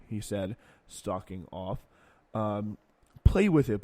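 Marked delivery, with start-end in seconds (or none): 3.2-3.8 loud, higher mocking repeat; indignant repeat. Shown as a demonstration. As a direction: spit the quote back, disgusted. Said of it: "by the way, is a pretty bracing".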